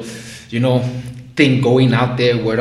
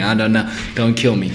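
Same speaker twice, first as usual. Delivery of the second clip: ordinary talk, nothing marked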